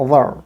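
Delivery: THERE with the sounds run together, schwa vowel; weak form. Shown as a demonstration. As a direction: blend 'there' into a reduced 'ther'. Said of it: "রা এবং রা একসাথে করে". ('there' শোয়া দিয়ে, ধ্বনি একসাথে মিলিয়ে)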